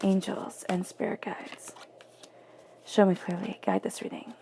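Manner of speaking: hushed card-reader's prayer; murmured invocation. Acted. Murmured invocation over the cards.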